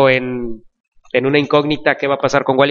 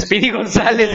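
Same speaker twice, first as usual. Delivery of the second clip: mock Speedy Gonzales; 4 semitones higher than usual. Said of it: "dice Chucho".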